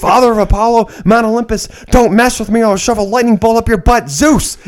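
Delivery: high-pitched, indignant movie threat; Samuel Jackson. Retold or imitated. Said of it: "that's Samuel Jackson".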